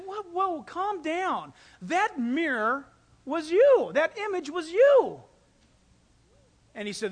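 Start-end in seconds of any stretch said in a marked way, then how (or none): 0.0-1.5 high, urgent soothing; alarmed calming. Retold or imitated. Missionary calming the stomping chief, high and urgent. 1.8-5.2 slow, louder stress on you; emphatic reveal. Retold or imitated. Missionary telling the chief the truth, slow emphatic stress.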